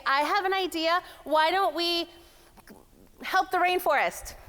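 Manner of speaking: high-pitched boardroom suggestion; high female pitch. Acted. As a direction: pitch the idea up high, tentatively paced. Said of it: "up here on the high sound".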